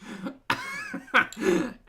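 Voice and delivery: real high-pitched helpless laugh; high squealing laugh. Real, not acted. A host cracks up at her own Ocker French laughing.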